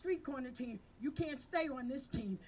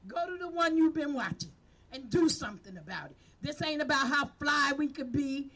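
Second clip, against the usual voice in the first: rally them like a pulpit preacher; preaching at full force; 2 semitones higher, 6 dB louder than usual. Real, not acted. Community leader urging her outreach team, preaching at full force.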